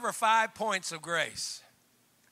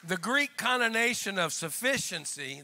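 usual speaking voice

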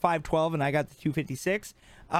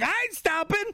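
high, frantic squeal of refusal; high-pitched mock protest; high mock panic; 13 semitones higher than usual. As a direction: squeak it out like a scared kid fleeing. Fleeing pushy tamale sellers.

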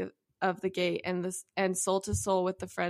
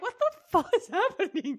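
much higher, laughing mock shock; shocked disbelief; 10 semitones higher than usual. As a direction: blurt it out, stunned and laughing. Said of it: "you're like".

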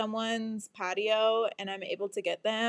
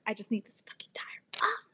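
voiceless, softer mock despair; fed-up whisper; 5 dB quieter than usual. Driver told no key exists, fed-up whisper. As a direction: whisper it like you are at the end of your rope.

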